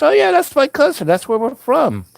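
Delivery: high mock voice of a cheery cousin; cheery carnival guy. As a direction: chirp it like a proud relative. Imitating Mickey's cheerful reply.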